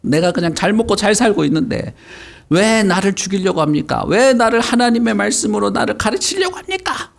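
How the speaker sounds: high-pitched, pleading outburst; acted yelling. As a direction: cry out in protest as the possessed man. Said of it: "막 소리를 지르기 시작한 거야".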